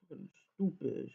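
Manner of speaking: ordinary delivery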